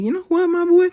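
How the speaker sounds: high-pitched, loud, excited call. Retold or imitated. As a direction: squeal it out, buzzing with joy. Quoting himself feeling great.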